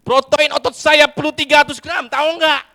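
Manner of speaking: high, loud indignant rant; angry rant. Acted. Husband rants at his wife about muscle protein, high and loud. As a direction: lecture her, all fired up.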